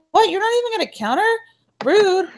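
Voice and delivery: high, fast, incredulous huff; offended outrage. Retold or imitated. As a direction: snap back in shocked indignation. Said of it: "So then I was kind of pissed".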